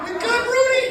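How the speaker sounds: hammed-up high-pitched friend voice; imitating Tim. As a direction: squeak it out like a buddy checking in. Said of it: "He's like".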